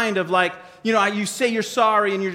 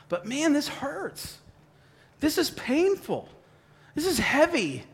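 a touch of pained, higher and slow; pained prayer; 7 semitones higher, 5 dB quieter than usual. Acted. Believer praying to God about hurt, mildly anguished. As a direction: sigh it out as if it really hurts. Praying through painful forgiveness, acted.

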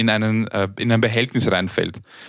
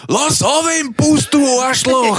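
very high, drawn-out shout to the front of the bus; imitated loud yell; 14 semitones higher, 4 dB louder, slower than usual. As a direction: yell it like an outraged passenger, stretched and high. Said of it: "sie schreit ganz laut". (gellend nach vorne geschrien, nachgemacht)

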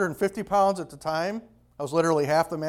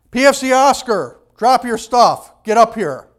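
loud, higher command across the formation; calling out; 5 semitones higher, 6 dB louder than usual. Retold or imitated. Drill sergeant calling the private out of formation, loud. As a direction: summon him up front, loud. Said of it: "he called out".

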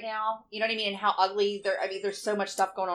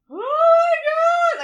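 high, loud mock wail; 11 dB louder than usual. Mom retelling her son's text, wailing high. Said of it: "And I'm like".

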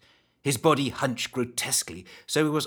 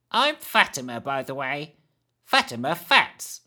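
put-on old hag voice; 3 semitones higher, 3 dB louder than usual. The hag's voice.

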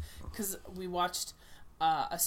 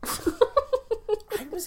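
laughing; 9 dB louder than usual. Real, not acted. Laughing disbelief.

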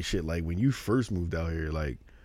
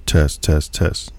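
louder punchy test, test; loud mic check; 5 semitones lower, 10 dB louder, much slower than usual. Shown as a demonstration. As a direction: project it into the mic. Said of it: "It still sounds far away".

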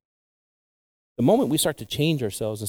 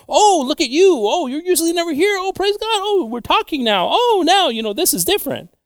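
much higher, gushing welcome voice; gushing church greeter; 5 dB louder than usual. Pretend. Church member gushing over a newcomer, much higher voice. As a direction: fawn over a rare visitor at church.

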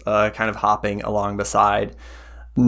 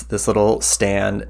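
usual speaking voice

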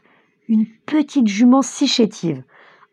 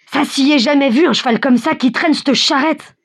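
bystander; 7 semitones higher than usual. A narrator's reading. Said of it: "ajoute un autre".

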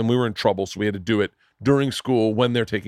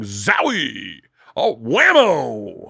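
slow, drawn-out hammed-up sportscaster calls; mock sportscaster; much slower than usual. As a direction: ham up an old-school highlight announcer, slowly.

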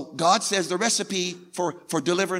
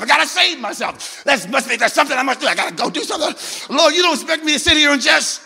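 a hint of a flustered believer, higher; hammed-up worry, pitched up; mock panicked believer; 6 semitones higher than usual. Preacher acting a believer arguing with God, frantic.

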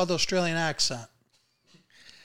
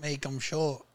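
Australian echo, keeping the words short; attempted Australian accent; 3 semitones lower, 7 dB quieter, slower than usual. Shown as a demonstration. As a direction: copy the coach's Australian, a little lower and softer. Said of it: "don't, like, make the words too long".

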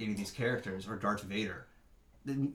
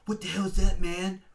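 high, loud mock alarm; startled kid; 10 semitones higher, 8 dB louder than usual. Kid trips over a leg, acted startled outburst. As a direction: play a startled discovery, pitched up and loud.

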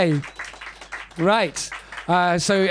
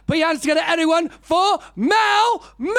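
announcer intro; 10 semitones higher than usual. Real, not acted. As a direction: hype the crowd for the next act. Compere introducing next act.

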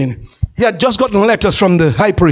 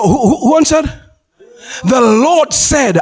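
ordinary talk, nothing marked

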